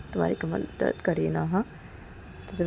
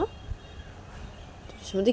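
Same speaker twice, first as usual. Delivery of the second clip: usual speaking voice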